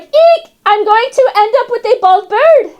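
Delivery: high squeal of mock alarm; mock alarmed Rosa. Parrot imitating Rosa's squeal over plucked feathers. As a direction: shriek it like a startled owner. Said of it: "She was like".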